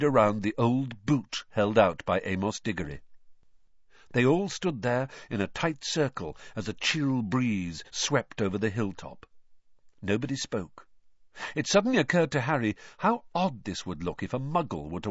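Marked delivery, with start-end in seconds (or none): none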